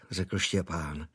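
plain reading voice

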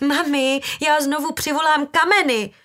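high, loud warning; desperate threat. A narrator's reading. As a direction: shout the threat at your mother. Daughter threatens her mother.

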